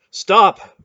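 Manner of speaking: a sudden loud high "Stop!"; sharp shout. Acted. Relg shouting at the group to halt, loud and sudden. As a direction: yell to freeze everyone in place.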